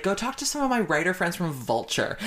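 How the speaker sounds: quoted party host. Retold or imitated. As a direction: lift it up, urging him along.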